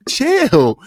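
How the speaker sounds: chill jumping way up high; high squeaky chill. Real, not acted. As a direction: shoot the word up high to calm the laughter.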